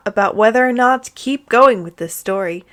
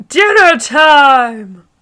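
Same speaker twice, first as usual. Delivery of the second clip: slow, higher and louder call up the stairs; sing-song call; 5 semitones higher, 7 dB louder, much slower than usual. Acted. Father calling his daughter down for dinner, slow and loud. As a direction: stretch it out like calling kids.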